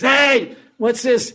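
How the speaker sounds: high loud startled cry; startled shout. Acted. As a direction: shout in shock at the theft. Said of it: "he goes".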